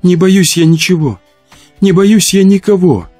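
high, mournful rhyme; tearful moan.

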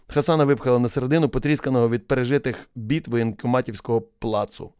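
ordinary delivery

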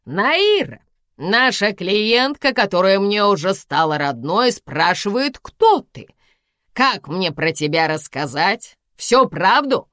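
loud, high call across the room, slow in places. A narrator's reading. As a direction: shout it into the hall, needling. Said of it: "крикнула в зал она". (громко кричит в зал)

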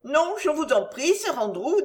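a gentle noblewoman begging him not to worry; Marguerite's voice. Acted.

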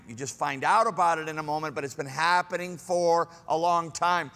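ordinary talk, nothing marked

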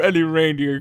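normal talking voice

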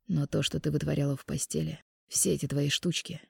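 low, soft disgusted breath. A narrator's reading. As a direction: exhale it with distaste. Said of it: "Чуть ли не с отвращением выдохнул он".

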